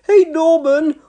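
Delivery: high, loud warning call. A narrator's reading. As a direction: call out to stop him. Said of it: "warned Elvis".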